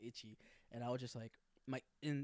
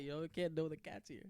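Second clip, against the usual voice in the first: normal talking voice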